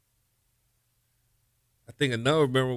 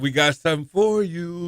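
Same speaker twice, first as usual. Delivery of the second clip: higher, sing-out mock taunt; imitated taunting; 6 semitones higher than usual. Rival players messing with him before kickoff, imitated taunting. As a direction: tease like a cocky opponent calling out. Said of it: "they was messing with you".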